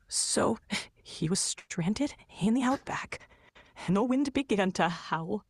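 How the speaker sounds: slow, hushed, fearful telling into a whisper. Acted. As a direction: draw out the dread, hushed. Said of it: "You don't sound scared enough".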